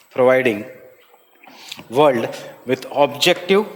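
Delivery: slow, measured note dictation; classroom dictation. Real, not acted. Teacher dictates notes on IPCC to students, slow dictation. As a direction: dictate in short chunks, leaving long pauses.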